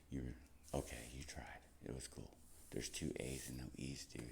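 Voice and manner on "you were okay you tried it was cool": whispered, teasing; whispered tease. Real, not acted. Teasing herself about the name, whispered. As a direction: mutter it under your breath, teasing.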